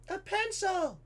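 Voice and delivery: high, put-on teacher voice. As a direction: announce it to the class in a high-pitched voice. Teacher answers in acted voice.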